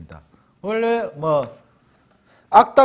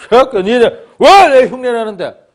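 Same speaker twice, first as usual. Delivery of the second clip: loud, high, slow gangster snarl; thug picking a fight; 8 semitones higher, 12 dB louder, slower than usual. Acted. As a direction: pick a fight like a street thug. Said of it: "하고 자꾸 시비를 겁니다". (시비 거는 깡패처럼 높고 크게 으르렁)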